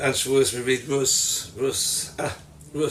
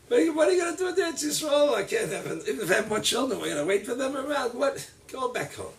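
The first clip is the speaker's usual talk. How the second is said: higher, rushed exasperated plea; exasperated Naomi; 9 semitones higher, faster than usual. Acted. Naomi pressing the girls to return, rushed. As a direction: hurry the objections out.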